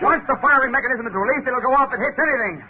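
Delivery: high-pitched alarmed urgency; rising alarm. Acted. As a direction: talk fast as danger approaches. Bomb diving toward them.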